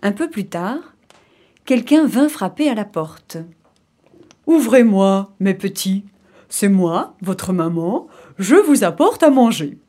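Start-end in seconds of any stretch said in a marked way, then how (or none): 4.5-9.8 big, loud wolf posing as mother; gruff wolf voice. Acted. Wolf pretends to be mother at the door, gruff big voice. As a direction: put on the wolf's big coarse voice. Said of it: "la grosse voix du loup".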